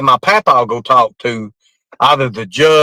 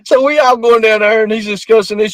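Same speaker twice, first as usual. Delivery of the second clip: usual speaking voice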